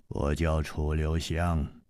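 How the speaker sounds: low, soft, slow self-introduction; 楚留香 revealing himself. Acted. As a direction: say your name quietly, unhurried. (楚留香低沉缓慢地自报姓名)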